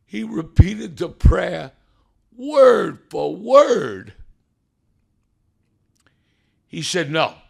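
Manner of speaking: loud, high, drawn-out proclamation; triumphant preacher's cry, fading at the end; preaching at full force. Acted. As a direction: shout the victory to the congregation. Preaching the judge's ruling.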